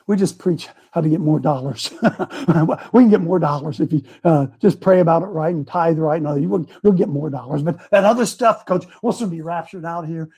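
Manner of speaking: low, soft, placating pastor; mock pastor. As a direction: soothe him like a smug pastor. Pastor voiced, placating coach.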